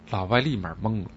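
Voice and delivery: ordinary talk, nothing marked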